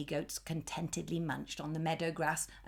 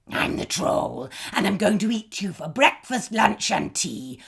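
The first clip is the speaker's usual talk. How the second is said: lightly voiced troll, louder; hungry troll; 2 semitones higher, 8 dB louder than usual. A narrator's reading. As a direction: threaten him with relish. Troll threatens again.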